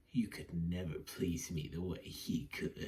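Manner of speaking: low, soft put-on voice; mock taunting wife. Reviewer playing the wife taunting her husband, low mock taunt. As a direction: imitate her taunt, dropped low and hushed.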